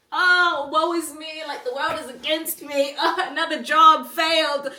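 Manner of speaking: high, loud mock wailing; mock self-pity. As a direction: ham up a woe-is-me lament, laughing through it. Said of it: "I don't even feel emotional about it".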